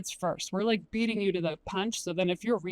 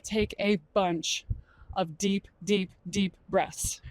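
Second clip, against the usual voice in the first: slow emphasis; much slower than usual. Shown as a demonstration. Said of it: "this is gonna sound crazy".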